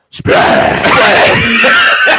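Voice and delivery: garbled wrestler catchphrase; mock unintelligible promo shout; Tsunami. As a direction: blurt the word out as a distorted holler. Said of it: "the only word we can understand tsunami say".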